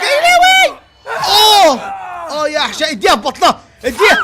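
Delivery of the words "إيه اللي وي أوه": sudden high loud yelp; shocked squeal at the screen; high surprised shout; startled shriek. Real, not acted. Startled by monster.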